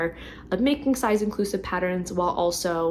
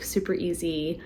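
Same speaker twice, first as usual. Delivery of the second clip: ordinary talk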